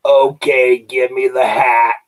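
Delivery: put-on a slow old-woman shout; crackly granny yelling for the hat; old lady demand. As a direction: bark it like a tiny old lady. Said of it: "hundred and thirty-seven-year-old woman was".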